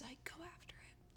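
soft urgent whisper. Real, not acted. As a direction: whisper it like a secret urge. Urging friend to chase love.

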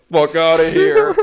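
high, loud burst; mock eager escape. Driver after dropping the stranger off, exaggerated urge to flee. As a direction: blurt it out, itching to leave.

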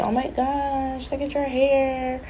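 high, slow gushing stranger voice; mock-gushing high voice; nosy stranger. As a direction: coo over the hair like a nosy fan. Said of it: "direct their energy to me".

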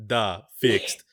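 put-on terse, heavy Belarusian accent. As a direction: imitate the Belarusian coworker on a call. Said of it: "she calls up and she's like".

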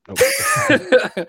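squeaky, very high drawn-out nope; playful falsetto nope; high mock nope. Real, not acted. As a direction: pitch the nope way up, teasing. Denying wanker meaning.

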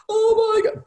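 retold gasp of joy, shooting way up high; overwhelmed surprise. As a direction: imitate the wife seeing her mare arrive, overcome. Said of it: "burst into tears".

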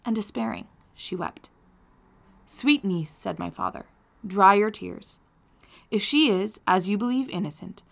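measured, expressive audiobook reading; story narration. A narrator's reading. Reading Frankenstein aloud to listeners, expressive story narration. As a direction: read the novel aloud with feeling.

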